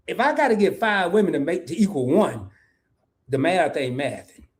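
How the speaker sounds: ordinary talk, nothing marked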